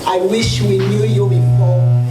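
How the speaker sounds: ordinary talk, nothing marked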